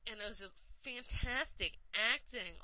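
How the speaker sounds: ordinary talk, nothing marked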